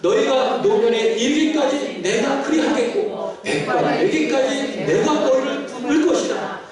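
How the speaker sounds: impassioned pulpit proclamation; fervent preaching. Real, not acted. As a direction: proclaim it to the congregation. Preaches at full force to congregation.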